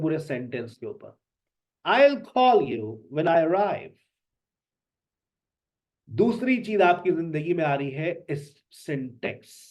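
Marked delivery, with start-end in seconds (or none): 1.8-4.0 very slow and louder, stressed words; slow stressed sentence. Shown as a demonstration. Teacher models sentence stress for students. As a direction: lay the sentence out slowly with stress. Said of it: "words के ऊपर भी stress करते हैं".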